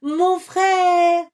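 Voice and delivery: high, loud call. Acted. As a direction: cry out for the lost brother. Carmelito accourt. (Carmelito appelle son frère, fort et aigu)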